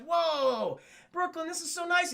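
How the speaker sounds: high-pitched mock wonder; amazed. Goliath seeing the Cloisters, amazed. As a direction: gush in wonder at the place.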